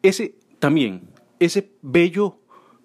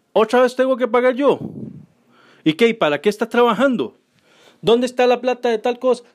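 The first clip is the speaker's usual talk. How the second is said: nagging partner; 6 semitones higher than usual. Retold or imitated. Later couple complaining about paying.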